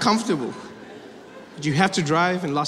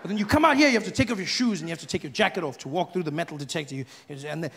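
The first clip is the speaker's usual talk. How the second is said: ordinary delivery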